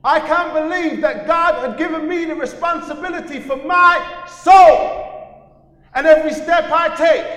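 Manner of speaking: high, loud American preacher shout; American preacher accent. Retold or imitated. American preacher shouting to the congregation, American accent. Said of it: "not a bad American accent".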